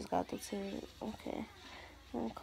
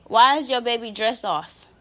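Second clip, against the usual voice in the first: loud scolding; 3 semitones higher, 14 dB louder than usual. Real, not acted. Mother scolds child loudly.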